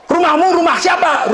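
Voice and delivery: high pointed accusing question; stern interrogation. Retold or imitated. Angel presses the sinner about his house, high and pointed.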